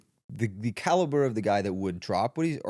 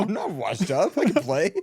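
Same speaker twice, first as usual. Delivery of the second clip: high, louder mock protest; pleading old player; 6 semitones higher, 3 dB louder than usual. Old player begging the GM, high mock pleading. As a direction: insist in a high, hammed-up pleading voice. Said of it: "players that played ten years ago".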